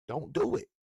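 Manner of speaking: high squeaky teasing warning; mock warning. Real, not acted. Telling coach Dave not to take accountability, mock high warning. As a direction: wag a finger at him in a high voice.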